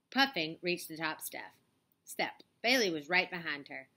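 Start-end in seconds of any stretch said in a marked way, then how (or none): none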